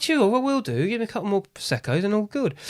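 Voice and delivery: high, breezy mock-keen yes; high mock-cheery brush-off; sarcastic agreement. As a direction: brush him off with fake enthusiasm. Sarcastic reply to pushy manager.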